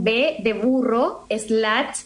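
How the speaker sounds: slow, careful letter-by-letter spelling; over enunciating. Shown as a demonstration. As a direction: spell it out slowly and clearly. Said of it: "lo voy deletreando así".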